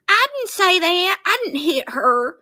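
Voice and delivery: high-pitched mocking denial. Mimics Brandy denying the hit.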